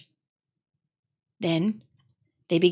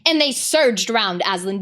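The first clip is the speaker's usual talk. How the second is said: ordinary delivery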